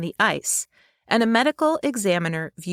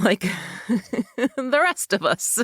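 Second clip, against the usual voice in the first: breaking into a real laugh mid-sentence; 3 semitones higher, much slower than usual. Real, not acted. Narrator jokes with companion about sharing the Iceman's pain, laughing. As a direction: let laughter bubble through the words.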